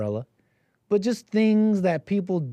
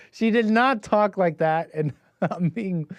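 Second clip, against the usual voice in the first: ordinary delivery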